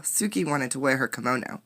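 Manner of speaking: ordinary delivery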